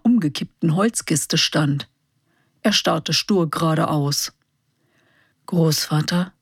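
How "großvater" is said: ordinary delivery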